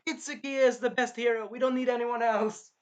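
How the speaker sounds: high, loud imitation of a loyal fanatic; mock defiant declaration; defiant follower. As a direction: voice pushed up, insistent. Voices defiant loyal companion.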